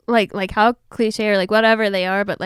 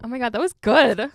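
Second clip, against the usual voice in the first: ordinary delivery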